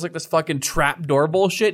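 ordinary talk

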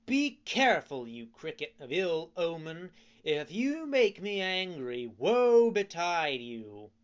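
angry threat. A narrator's reading. Pinocchio threatening the cricket, loud and furious. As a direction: bark the threat at him.

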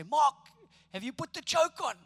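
father calling through the house; dad calling from inside. Retold or imitated. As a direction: shout it from indoors to the kid outside. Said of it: "I'd hear my dad's voice, from the inside of the house".

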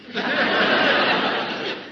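high breathy laugh at his own joke; airy cackle after the jab. Real, not acted. After needling Tallulah, a high breathy laugh.

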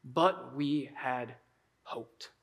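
very slow, soft and low; disappointed. Acted. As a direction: voice sinking away in dejection. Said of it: "unmet expectations and disappointment".

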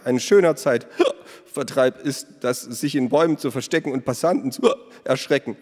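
high, loud, with a laugh in it; indignant baker. A narrator's reading. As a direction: grumble at the prank half-laughing. Startled baker complains. (der Bäcker beschwert sich laut, hoch und halb lachend)

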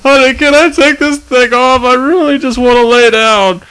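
high, pleading husband voice; whiny husband. Acted. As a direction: whine to your wife. Husband in the ad skit.

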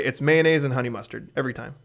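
ordinary talk

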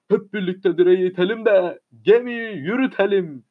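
ordinary delivery

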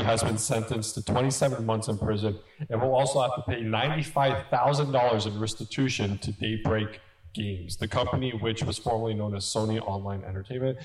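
low, even news-reading voice. Real, not acted. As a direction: read the report straight through, pitch kept low. Reads out a news item.

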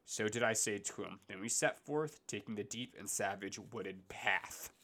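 put-on measured, solemn reading of old verse; poem reading. Podcast host reading Dante aloud, solemn poem reading. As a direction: read it like a classic epic poem.

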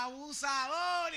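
high, slow accusing shout; drawn-out yell of abuser; mock yell. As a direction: call him out like an outraged bystander. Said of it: "la gente le gritaba".

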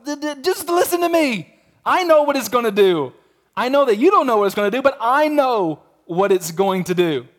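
high, exasperated cutting-in, stuttering at first; insistent God. Acted. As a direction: impatiently talk over an objection, stressing I KNOW.

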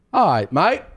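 high mock English accent; bad English accent. Comic clowning for co-host, bad high English accent. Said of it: "worst at English accent".

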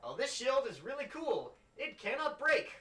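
high, loud showing-off voice; enthusiastic wizard. Acted. Wizard shows off wares to the player, enthusiastic. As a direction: pitch it up like an eager seller. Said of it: "And he goes".

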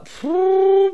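long high whistling phew; drawn-out phew. Real, not acted. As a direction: blow out a long, high whew. Recalling a heavy party night.

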